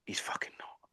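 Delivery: whispered disbelief; stunned whisper. Retold or imitated. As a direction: whisper it like you can't believe it. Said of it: "And he was like".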